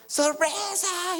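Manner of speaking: high, drawn-out "¡Sorpresa!" cheer; party surprise shout. Retold or imitated. Guests at the surprise party yelling to him, drawn-out high cheer. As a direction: stretch it out like a crowd yelling surprise.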